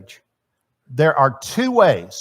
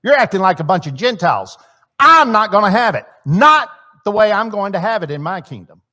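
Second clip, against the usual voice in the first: higher, louder and fast, rebuking shout; 6 semitones higher, 8 dB louder than usual. Acted. Jesus shouting at his disciples, loud and angry. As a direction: yell it at the disciples, fed up. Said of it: "it usually means somebody's shouting".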